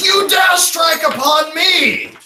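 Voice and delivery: high, loud, drawn-out villain shout; outraged villain cry. Acted. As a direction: roar it slowly as an affronted villain. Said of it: "As it cries out".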